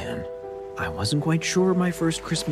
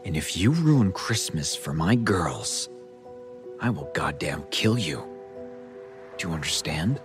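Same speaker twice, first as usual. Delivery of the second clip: quiet menace; 4 dB quieter than usual. Acted. Dad threatening boyfriend over his girls' Christmas, quiet menace. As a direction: warn him deadly serious, without raising your voice.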